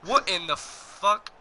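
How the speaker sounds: stunned disbelief. Real, not acted. Gamer stunned by a play, slow disbelief. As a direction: drag it out in shock. Reacting to game moment.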